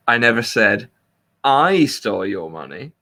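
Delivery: pause, then stressed "I" before "stole"; stress on second I. Shown as a demonstration. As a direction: break after "said" and hit "I". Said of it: "You can put the emphasis".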